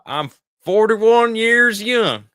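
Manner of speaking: put-on high, slow Western old-timer; loud, drawn-out old-timer with a Western twang; old person's voice. Shown as a demonstration. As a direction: stretch it out like an old cowboy on the porch. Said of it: "say it in an old person's voice".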